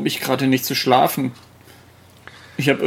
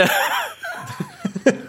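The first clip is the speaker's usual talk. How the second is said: high laugh; 5 dB quieter than usual. Real, not acted. Giggling after improv remark.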